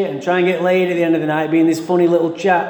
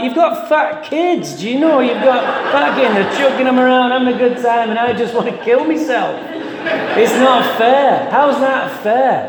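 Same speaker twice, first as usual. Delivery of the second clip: higher, whiny complaint about the unfairness; mock despair, pitched up; exasperated inner voice; 7 semitones higher than usual. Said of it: "I'm like".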